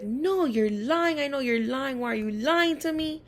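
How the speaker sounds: retold teacher calling her a liar; accusing teacher. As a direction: drag out the accusation like a sure, angry teacher. Teacher accusing student of lying.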